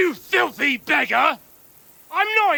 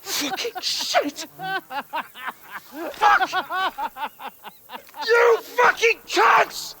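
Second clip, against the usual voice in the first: angry guard cursing; 7 semitones higher than usual. Acted. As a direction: spit curses down at the strangers. Guard cursing at gate callers.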